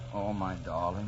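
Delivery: soft lament. Acted. Delirious man mourns Kathy.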